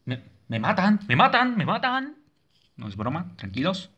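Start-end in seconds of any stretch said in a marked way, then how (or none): 0.0-2.2 high, rising yelps; playful, high-pitched panic; panicked cry. Real, not acted. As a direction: cry out as if being killed. Being killed in game.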